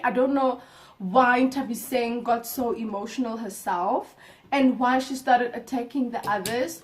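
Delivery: ordinary talk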